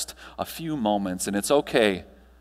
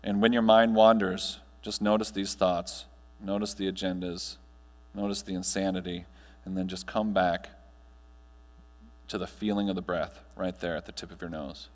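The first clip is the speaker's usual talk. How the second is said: guided meditation; 3 semitones lower than usual. Acted. Speaker guiding the audience through breathing, calm meditation voice. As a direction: ease the room gently into stillness.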